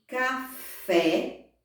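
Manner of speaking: "Café" split ca-FÉ, weight on fé; stress on last syllable. Shown as a demonstration. Teacher gives oxytone example, stressing last syllable. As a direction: separate "Café" and lean on the final fé. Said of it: "elas têm a última sílaba mais forte".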